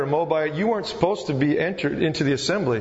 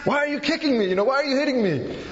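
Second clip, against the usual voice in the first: much higher, whiny cartoon donkey; Shrek Donkey voice; 9 semitones higher, faster than usual. Preacher voicing the kicked donkey to the congregation, high whine. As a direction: squeal like a hurt cartoon donkey. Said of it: "Donkey on Shrek".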